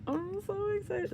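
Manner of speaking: high, squealed excitement; squealing excitement. Real, not acted. Fan seeing his photo squeals with excitement. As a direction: squeak it out with joy. Fan gushing over member.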